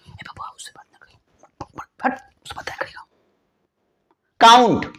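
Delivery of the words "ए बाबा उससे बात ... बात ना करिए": hushed mocking whisper; whispered gossip. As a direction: whisper it like nosy neighbours. Society gossiping about a bad girl.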